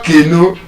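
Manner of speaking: Kenu as KAY-nu, front-stressed; wrong pronunciation. Shown as a demonstration. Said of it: "All of them are still wrong".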